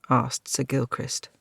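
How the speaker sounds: usual speaking voice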